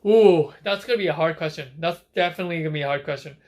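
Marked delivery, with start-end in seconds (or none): none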